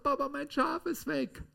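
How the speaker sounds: put-on high, pleading child's voice; a small boy crying to his papa; boy runs to his father, voiced high and upset; distraught little boy. Boy tells father sheep gone.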